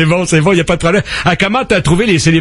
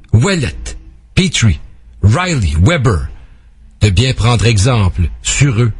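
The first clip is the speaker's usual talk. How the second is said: measured ode delivery with dramatic pauses; formal, phrase-by-phrase poetic reading; solemn poem recitation; 4 semitones lower than usual. Acted. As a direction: declaim a tribute poem to hockey heroes. Reads a tribute poem.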